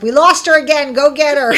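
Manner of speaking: high, loud call across the house, half laughing; mock exasperated call. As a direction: call out to fetch her back, playfully. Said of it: "they'd be like".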